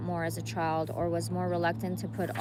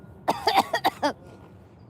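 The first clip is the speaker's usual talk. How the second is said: 1 crying cough; 12 semitones higher, 5 dB louder than usual. Real, not acted. Crying, stops reading aloud.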